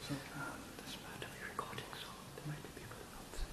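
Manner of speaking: hushed, unvoiced whisper before the service; real whisper. Real, not acted. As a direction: whisper so nobody outside hears. Worried people outside might hear.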